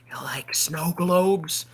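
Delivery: slow whispered creepy offer; slow whispered Tim Allen. Retold or imitated. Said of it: "And Tim Allen goes".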